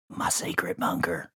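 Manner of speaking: hushed secret whisper. Acted. Whispering his secret hideout.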